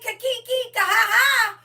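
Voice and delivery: loud mocking "ka-ha-ha" cackle. Mocking the doubters with a loud cackle. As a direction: laugh at them out loud.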